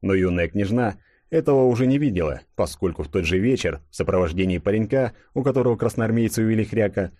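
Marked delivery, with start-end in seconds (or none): none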